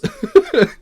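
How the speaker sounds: sudden high laugh; high burst of laughter. Real, not acted. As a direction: laugh out in disbelief. Laughing at the story.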